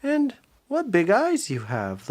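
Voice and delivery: put-on high, slow wondering girl; little girl voice. Minnie noticing the big ears, high slow wonder. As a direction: marvel slowly at granny's ears.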